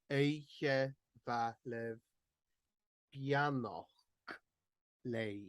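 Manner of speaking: "Eiche va lev" said slowly with the stress moved to "Eiche"; switched stress. Shown as a demonstration. Tutor showing learners the flipped stress, slowly. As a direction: lean on "Eiche" at a slow pace. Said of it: "we've switched around the stress of those two leaves".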